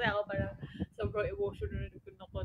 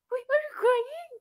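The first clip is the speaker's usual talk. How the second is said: high, slow imitated question; startled question; 13 semitones higher, 5 dB louder, slower than usual. Imitating her friend noticing her tears, startled. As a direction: mimic a high, louder check on someone. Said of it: "Tapos sabi niya".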